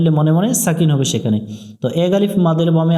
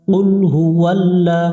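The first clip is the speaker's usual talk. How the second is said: slow, sung-through recitation; recited phrase; slower than usual. Shown as a demonstration. Teacher models the joined words, melodic recitation. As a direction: chant it smoothly.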